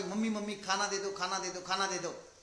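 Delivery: ordinary talk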